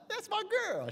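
high, loud proud exclaim; husband's high loud delight. Real, not acted. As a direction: burst out proud of her.

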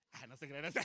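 ordinary delivery

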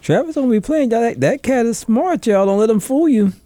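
big up your buddy; hype man voice. Pretend. Hyping up a friend.

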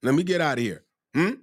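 ordinary talk

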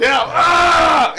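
loud mock yell. Real, not acted. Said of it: "He's like".

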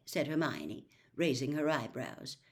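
plain reading voice

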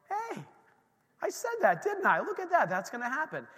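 high, gleeful told-you-so; delighted surprise. Retold or imitated. Pastor retelling his glee when the power came back on, excited. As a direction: crow happily that your words came true.

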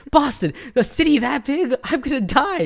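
high-pitched hammed-up dismay; exaggerated fretful squeal; mock panic. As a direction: panic comically at the very idea. Imitating panicked man refusing Boston.

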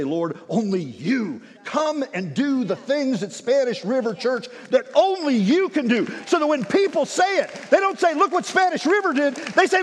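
fiery revival preaching; preaching at full force. Real, not acted. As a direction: drive it home to the whole church. Preaching climax.

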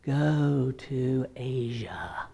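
slow, eerie drawn-out whisper; whispered fake God. Bible school prankster whispering from a closet as God, slow whisper. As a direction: whisper it spookily, pretending to be God. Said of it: "hiding in their closets and speaking".

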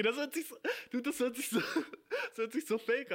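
real laugh interrupting the sentence. Real, not acted. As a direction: giggle through the words, incredulous. Breaks up laughing at own story.

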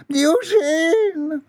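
very high, wailing call; mother's cry. A narrator's reading. Mother calls her son from the bush, high wailing cry. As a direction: stretch the name in falsetto.

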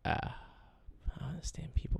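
slow, whispered disbelief; exasperated whisper. Real, not acted. DJ whispering his disbelief at callers, slow. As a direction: breathe it out, fed up. Exasperated aside after rant.

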